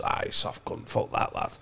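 scornful lizard, under the breath. Pretend. Lizard voiced in retold story.

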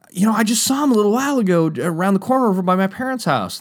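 put-on high, quick, overly eager kid fib; fake casual lie. New kid lying to the skaters, high and quick. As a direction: rattle off the lie too eagerly.

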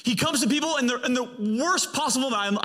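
high-pitched, emphatic preacher; fervent preaching. Real, not acted. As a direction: preach it with rising fervour.

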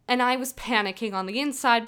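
ordinary delivery

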